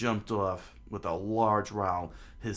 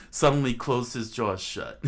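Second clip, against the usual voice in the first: louder, cracking up through it; giggly louder redo; laughing retake; 4 semitones higher, 8 dB louder than usual. Real, not acted. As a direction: say it again while laughing. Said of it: "retakes the line laughing".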